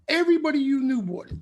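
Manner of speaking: ordinary talk, nothing marked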